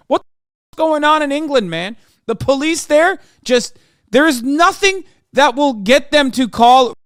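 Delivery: high, loud exasperated disbelief; incredulous outburst. Real, not acted. Exasperated rant at English police.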